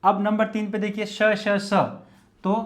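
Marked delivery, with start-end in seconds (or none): none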